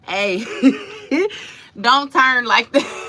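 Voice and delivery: breaking into a high laugh; giggling as she scolds. Real, not acted. Laughing at herself mid-story.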